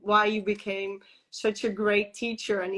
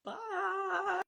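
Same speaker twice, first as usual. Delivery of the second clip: lighter, softer, higher farewell; mock cheery bye; 8 semitones higher, 9 dB quieter than usual. Mother glad to see the midwife leave, light mock goodbye. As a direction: wave her off in a sing-song voice. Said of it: "You know what I thought?".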